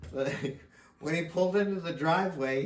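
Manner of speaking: ordinary talk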